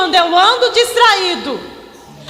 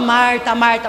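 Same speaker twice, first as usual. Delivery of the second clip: solemn gentle intonation; 7 semitones lower, slower than usual. Shown as a demonstration. Preacher showing the usual Bible-reading tone, gently solemn. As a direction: say the name low and evenly, reverently. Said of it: "Vamos mudar a entonação aqui, não vamos falar".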